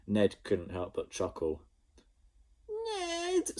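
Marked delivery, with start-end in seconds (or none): 2.7-3.6 screamed. A narrator's reading. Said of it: "screamed Jemima".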